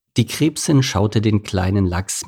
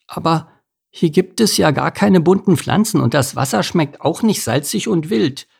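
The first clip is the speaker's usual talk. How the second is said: high, earnest little fish; a dejected little salmon, pitched up; young salmon Lars; 7 semitones higher than usual. Acted. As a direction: protest like a small child. Lars disappointed by the lake.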